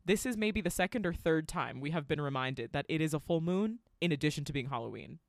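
ordinary talk

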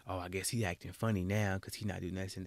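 soft, muttered side remark about him; coworker gossip. Retold or imitated. Coworkers whisper he is acting funny, quiet snide. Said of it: "I'm having a day".

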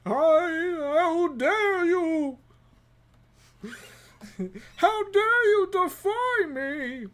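high, slow villain outrage; hammed-up villain; mock outrage. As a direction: ham up the offended greeter. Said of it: "door greeter".

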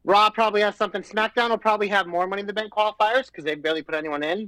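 ordinary talk, nothing marked

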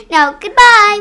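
high, loud farewell; loud goodbye. Real, not acted. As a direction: call out goodbye.

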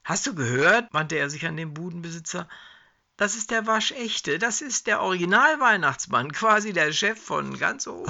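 high, giddy voice full of mockery; gleeful mocking Herbie. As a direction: show off the joke to the stall owner. Herbie mocking to the stall owner. (Herbie ruft dem Budenbesitzer spöttisch und aufgedreht zu)

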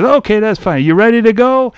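high-pitched game-show host question; Bobby Van. Retold or imitated. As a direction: imitate an emcee chatting up a contestant. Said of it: "And he says".

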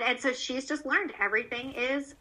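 usual speaking voice

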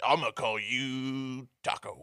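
slow, drawn-out mock insult; softly voiced, slow mock sheriff. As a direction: draw it out softly like a lazy bigot. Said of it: "he's just like".

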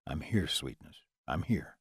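low, soft male murmur; tender low reassurance. Acted. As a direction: hush her gently in a low voice. Lover soothes her after climax.

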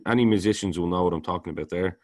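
ordinary talk, nothing marked

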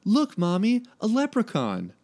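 put-on high-pitched little girl voice. A narrator's reading. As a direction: pipe up high like a small child. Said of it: "a little girl tugged".

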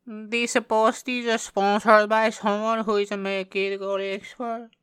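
high-pitched hammed-up Pakistani accent; joking sponsor read in a high Pakistani accent; mock Pakistani accent. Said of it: "you don't know what accent I'm doing".

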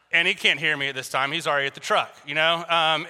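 normal talking voice

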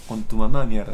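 loud, forceful retold order; imitated stern repeat. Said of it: "No entendí, pe. A la primera masoquista".

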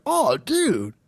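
high preppy exclamation; ultra-preppy guy. Retold or imitated. Preppy worker to a customer, high and drawn-out. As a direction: imitate a peppy dude in a tennis cap. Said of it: "and he's like".

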